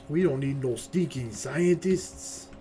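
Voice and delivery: slow, hammed-up Mexican accent; horrible Mexican accent. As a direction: draw it out in a bad Mexican accent. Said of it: "my horrible Mexican accent".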